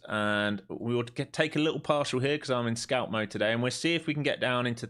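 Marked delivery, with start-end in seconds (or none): none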